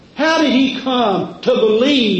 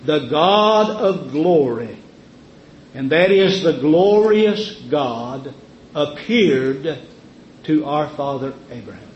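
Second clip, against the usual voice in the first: reverent quoting; 5 semitones lower than usual. Acted. Preacher quoting Stephen in awe. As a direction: draw out The God of glory. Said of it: "here's what Stephen said".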